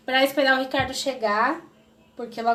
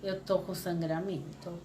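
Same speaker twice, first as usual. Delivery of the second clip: calm; 6 semitones lower, 10 dB quieter than usual. Retold or imitated. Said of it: "Eu fiz assim pra ele".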